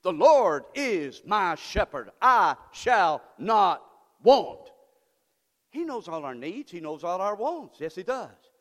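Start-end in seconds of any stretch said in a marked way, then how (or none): 0.0-4.7 father David proclaiming. Retold or imitated. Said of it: "David says".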